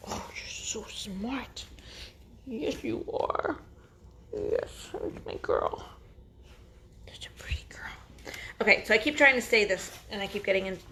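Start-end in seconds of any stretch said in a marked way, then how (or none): 0.1-8.3 soft slow whisper praising a pup; whispering to a puppy. Real, not acted. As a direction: murmur sweet praise to a puppy.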